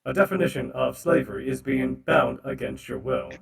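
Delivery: loud robotic recitation. Acted. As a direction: recite the definition like a machine. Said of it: "the robot voice".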